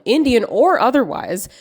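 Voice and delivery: ordinary delivery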